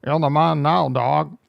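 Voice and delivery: lightly voiced Connery line; Sean Connery impression. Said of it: "It does kinda sound like Sean Connery".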